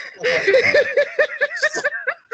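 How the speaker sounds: loud rising burst of laughter; loud high laugh. Real, not acted. Host cracking up about Lamar Odom win, loud laugh.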